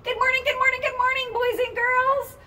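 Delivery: bright high greeting call; sing-song high greeting. Acted. Teacher calling good morning to boys and girls in a high voice.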